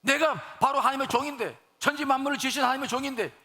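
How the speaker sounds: forceful preaching. Real, not acted. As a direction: drive the story home to the congregation. (높은 목소리로 힘주어 설교하듯)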